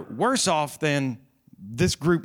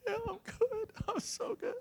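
much higher, choked, tearful squeak; crying while saying fine; 11 dB quieter than usual. Retold or imitated. Pastor retelling his answer to Aaron, crying voice. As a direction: imitate himself sobbing out that he's fine. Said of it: "I was still crying".

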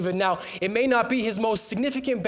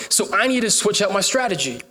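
usual speaking voice

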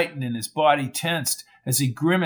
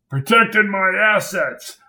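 muffled; 4 semitones higher than usual. A narrator's reading. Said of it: "said Lester in a muffled voice".

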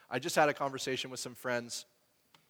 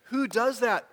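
high, loud who does that; incredulous; 10 semitones higher, 7 dB louder, slower than usual. Real, not acted. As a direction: ask it in open disbelief. Rhetorical disbelief.

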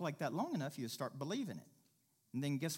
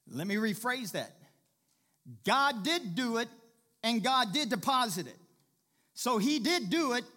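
higher, louder preacher's drive; preaching hard; 7 semitones higher, 8 dB louder than usual. Acted. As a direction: push the point home to the congregation. Preaching builds to full force.

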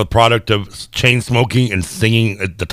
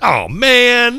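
dismayed. Retold or imitated. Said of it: "I was like".

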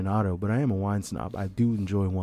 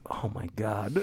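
stunned; 9 dB quieter, slower than usual. Retold or imitated. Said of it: "I was just like".

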